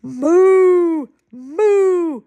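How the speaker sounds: loud, long moos; cow mooing. Acted. Moo Moo answers the book's question, mooing loudly. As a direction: moo it out and hold it. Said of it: "What do you say?".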